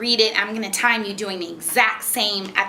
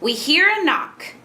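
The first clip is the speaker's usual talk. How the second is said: slow, high storybook line; expressive read-aloud; 6 semitones higher, slower than usual. Shown as a demonstration. Mother models a sentence for child to echo, slow and high. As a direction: read it slowly with a big pitch arc. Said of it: "try and match how I say it".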